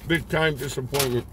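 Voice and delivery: words around a mouthful; talking while chewing. Real, not acted. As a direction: mouth partly full. Seen 0: Bite taken at -7; jaw and pursed lips keep chewing through the words.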